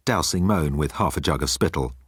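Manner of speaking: plain reading voice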